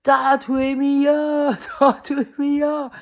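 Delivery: slow drawn-out mimicked yell; mock yell. Woman imitates ex-partner yelling outside, slow mock yell. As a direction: hams up her ex yelling at her, slow and loud. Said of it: "quand il me criait".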